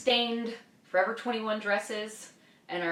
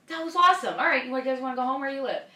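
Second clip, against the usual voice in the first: high, loud, upbeat mimicry; imitated cheerful praise; 7 semitones higher, 6 dB louder, faster than usual. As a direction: switch to chirpy approval, as if all were fine. Captor's suddenly cheerful praise afterwards.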